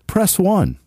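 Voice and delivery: recorded menu prompt; phone menu voice. Shown as a demonstration. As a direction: press one sung up high. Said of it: "I did some voice, uh, prompts for work".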